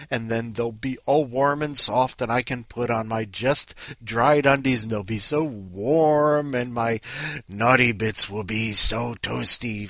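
loud, stretched-out key words; gleeful relish. Real, not acted. Host anticipating just-dried warm undies, gleefully indulgent. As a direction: savor the warmth, drawing out the words.

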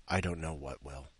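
ordinary delivery

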